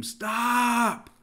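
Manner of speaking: high-pitched, drawn-out cry of alarm; mock scream. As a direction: scream it as if stopping a child at a hot stove. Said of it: "I would scream".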